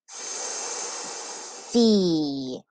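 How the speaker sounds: "See" with a long hissed s, then a long ee; sounding out. Shown as a demonstration. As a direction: stretch "See" into its two sounds. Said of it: "finger tap the sounds in each syllable".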